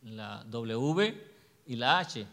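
letter by letter, slow and deliberate; name each letter slowly, one at a time; spelled out slowly. Shown as a demonstration. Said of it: "¿cómo se dice?". (deletreando despacio, letra por letra)

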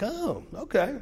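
high-pitched "oh" of surprise; mock surprise. Pastor hearing a biker's confession, high mock surprise. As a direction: react high, taken aback but playing along.

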